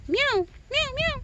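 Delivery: high meowing call to a cat. Pretend. Woman calling a stray cat, high mock meow.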